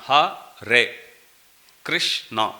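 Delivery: HARE KRISHNA split into Ha, re, Krish, na; syllable by syllable. Shown as a demonstration. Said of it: "every word is two syllables".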